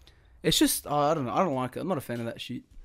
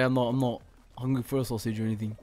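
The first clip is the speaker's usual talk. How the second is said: usual speaking voice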